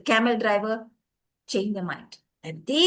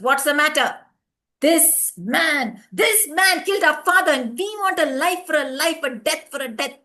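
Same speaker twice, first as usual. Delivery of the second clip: loud, higher demand for justice; angry accusation; 4 semitones higher, 6 dB louder than usual. A narrator's reading. Sons accuse before the judge.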